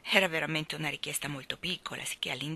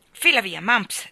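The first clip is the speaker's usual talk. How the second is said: sharp command; 2 semitones higher, 9 dB louder, slower than usual. A narrator's reading. Bob kicking his dog away, sharp loud command. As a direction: shoo the dog off loudly.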